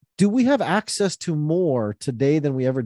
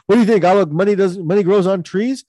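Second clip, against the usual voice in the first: dad scolding; 5 semitones higher, 5 dB louder than usual. Retold or imitated. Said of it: "My dad used to say that to me".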